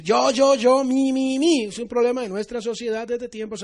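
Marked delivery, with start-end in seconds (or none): 0.0-1.6 louder, higher self-centred chant; mock selfish me-me. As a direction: ham up the egotist. Imita al egoísta: yo, yo. (egoísta exagerado, yo yo yo)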